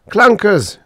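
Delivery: high, loud cry of alarm; gasped alarm. A narrator's reading. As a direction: cry it out in sudden shock. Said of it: "Drummond gasped".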